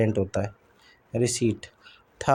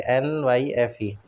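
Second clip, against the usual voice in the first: ordinary delivery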